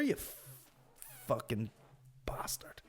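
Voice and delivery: soft, seething mock rage; whispered curse. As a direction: let the insult sink into a whisper. Pretend rage trailing into a whisper.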